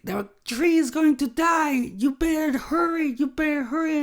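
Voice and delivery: very high, frantic mock wife; freaking-out wife. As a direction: imitate her panicked nagging to hurry. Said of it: "my wife is freaking out still".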